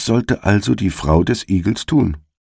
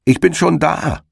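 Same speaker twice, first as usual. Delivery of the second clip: high smug called-out cry; calling out; 11 semitones higher than usual. A narrator's reading. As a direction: call it up the field, higher. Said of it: "rief ihm der Herr Igel entgegen". (hoch und selbstzufrieden gerufen)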